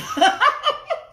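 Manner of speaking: loud high laugh; real laugh at the comments; burst of laughter. Real, not acted. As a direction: crack up laughing.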